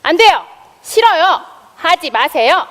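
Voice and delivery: high, loud, emphatic refusal; forceful demonstration yell, each phrase separate; demonstration shout. Shown as a demonstration. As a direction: shout it out as a model for children. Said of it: "이렇게 외치는 거야".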